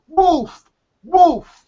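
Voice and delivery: high, loud storyteller's cry for help; acted shout. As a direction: call out as the desperate boy in the tale. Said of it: "shouts at the top of his lungs".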